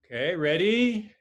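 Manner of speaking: calling out loud to the whole mat. Real, not acted. Instructor calls the class to get ready: loud call. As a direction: project the ready call across the room.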